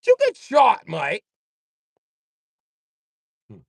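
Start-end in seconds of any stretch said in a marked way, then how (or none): 0.0-1.2 high, loud put-on English voice; hammed-up Brits asking if he got shot; mock British gossip. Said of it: "They're gonna be like".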